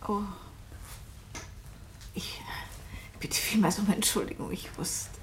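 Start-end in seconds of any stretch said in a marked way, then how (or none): 2.1-5.1 soft unvoiced whisper, faltering apology; embarrassed whisper. Guest apologizing to the hostess about her deaf daughter, whispering.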